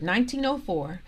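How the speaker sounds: usual speaking voice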